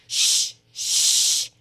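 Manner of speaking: forceful, long "shh"; loud shushing. A narrator's reading. Hatter and Hare silence Alice, shushing loudly. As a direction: hush someone sharply, twice. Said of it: "Hatter and the March Hare went".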